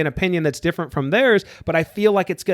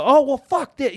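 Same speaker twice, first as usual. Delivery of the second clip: a mock pissed-off retort, pitched much higher; 10 semitones higher than usual. Grown child snapping back at a nagging mom, mock pissed off. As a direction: fling it back like you're fed up again. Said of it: "I wanna get pissed again and go".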